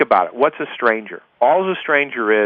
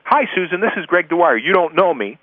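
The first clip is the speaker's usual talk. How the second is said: higher-pitched, pushy-sounding cold-call opener; mock the usual telemarketer greeting; salesperson's introduction; 4 semitones higher than usual. Shown as a demonstration. Caller introducing himself to unknown Susan, salesy introduction. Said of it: "instead of me saying".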